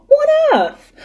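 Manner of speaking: what on earth, jumping up in pitch; flustered surprise, pushing on fast; startled high exclaim. Real, not acted. Startled by noise mid-reading.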